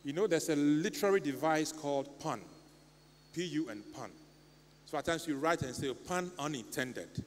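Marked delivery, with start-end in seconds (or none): none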